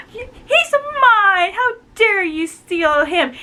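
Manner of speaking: high, slow, wailing jealous claim; jealous Ivy. Retold or imitated. Ivy wailing at the rival who stole her man. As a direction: whine it out like a jealous girl caught off guard. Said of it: "she's like".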